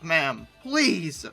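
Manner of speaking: ordinary talk, nothing marked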